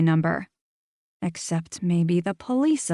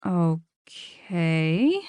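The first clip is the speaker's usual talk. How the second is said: drawn-out okay. Acted. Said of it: "drawing out the word".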